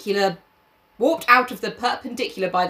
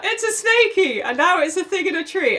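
put-on high, little-kid voice; high-pitched mock childish claim; imitating a child; 8 semitones higher than usual. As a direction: squeak it like a confused small boy. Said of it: "it's like".

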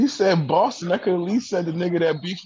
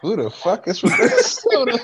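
higher, louder, cracking up mid-question; breaking into laughter; 5 semitones higher, 4 dB louder than usual. Real, not acted. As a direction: barely get it out through laughter. Cracks up over Minnesota.

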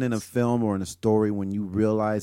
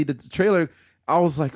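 ordinary delivery

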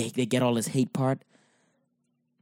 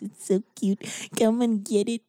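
much higher, sing-song cutesy coo; mock cutesy voice; 10 semitones higher than usual. Mocking cuddly preaching: high cutesy coo. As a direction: coo like you're luring a puppy, mocking it.

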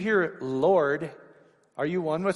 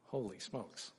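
a soft, lower, hushed holy smokes; awed hush; 4 semitones lower, 15 dB quieter, slower than usual. Real, not acted. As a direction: breathe it out in awe. Awed reaction to divine name.

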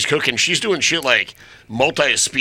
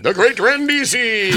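magician announcer; 8 semitones higher, slower than usual. Pretend. Mock stage magician intro.

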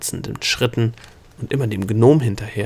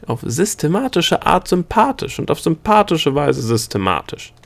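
higher, earnest yet playful gnome; gnome's odd compliment; 6 semitones higher than usual. A narrator's reading. Gnome telling the princess he likes her, playful and higher. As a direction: pile up the odd compliments with rising fervour.

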